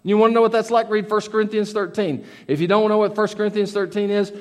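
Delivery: normal talking voice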